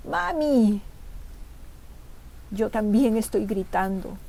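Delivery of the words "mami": put-on high child crying out for mommy; child's voice. A narrator's reading. Narrator imagines hearing the son's voice, high child's call. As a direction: call out like a small child, higher pitched. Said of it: "creo oír su voz".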